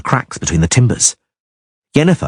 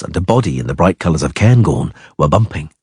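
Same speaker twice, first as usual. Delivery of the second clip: plain reading voice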